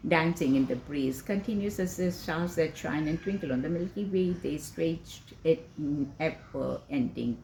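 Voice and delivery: measured, mostly low and soft poetic reading; poem recital. Acted. Recites Wordsworth's Daffodils poem.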